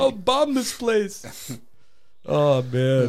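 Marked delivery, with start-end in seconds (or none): 0.0-1.6 put-on Arabic accent. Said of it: "like, an Arabic accent".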